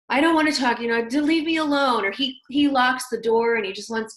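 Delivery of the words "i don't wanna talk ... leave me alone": louder, mock-annoyed brush-off from the tired husband; irritated husband. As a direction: push her away, fed up. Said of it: "and he's like".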